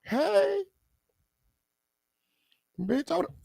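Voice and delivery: squeaky high mock bystander voice. Imitating a bystander yelling at Keefe D, high pitched. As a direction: play a shrieking onlooker. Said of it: "he just walk on over".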